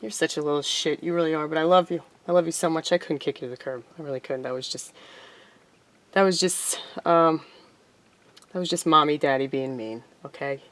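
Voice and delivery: loving owner soothing her cat after scolding it; tender talk to the cat. Real, not acted. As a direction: coo softly to your cat.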